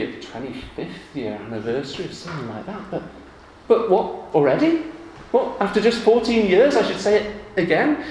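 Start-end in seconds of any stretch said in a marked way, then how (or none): 3.7-8.0 high, loud disbelieving protest; mock outrage. Husband protesting to the congregation, mock outraged. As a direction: scoff at saying it again.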